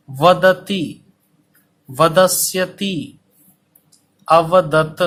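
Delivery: each syllable drawn out very slowly for copying; teacher dictating at a crawl; slow dictation. Shown as a demonstration. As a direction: spell it out slowly so students can write. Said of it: "इसीलिए धीरे धीरे बोल रहा हूँ".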